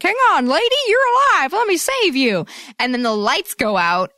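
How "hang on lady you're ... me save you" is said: high, eager rescuer voice; heroic rescuer. Retold or imitated. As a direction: call out bravely to the lady. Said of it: "he's like".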